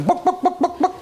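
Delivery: very high squawked book like a hen; hen clucking book. Acted. Librarian hears the hen squawk book. Hen asks for five books.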